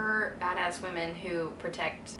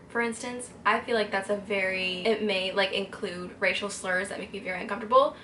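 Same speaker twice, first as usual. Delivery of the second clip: ordinary talk, nothing marked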